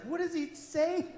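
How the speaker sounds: put-on high, small child's question; a child's high curious question; daughter asking. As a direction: ask like a curious little girl. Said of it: "My daughter's like".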